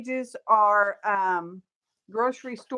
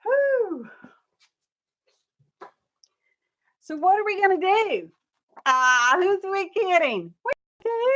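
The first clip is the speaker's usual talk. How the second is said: high, louder burst of energy; excited; 9 semitones higher, 5 dB louder than usual. Real, not acted. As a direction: bounce back in with high energy. Excited restart after setup.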